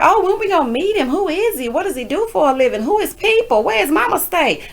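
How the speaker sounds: high rapid-fire nosy questions; high excited friends grilling her; eager questions. Retold or imitated. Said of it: "So they're like".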